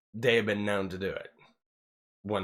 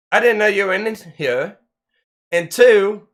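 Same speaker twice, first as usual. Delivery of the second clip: higher and louder, yelling at them; angry yell; 8 semitones higher, 7 dB louder than usual. Retold or imitated. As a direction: let it rip at the intruders. Retelling yelling at intruding women.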